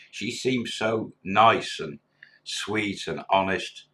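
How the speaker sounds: slow, lingering disbelief; slow wistful quote. Retold or imitated. Jilted friend telling the bar manager about her, slow. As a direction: drag it out like a man let down.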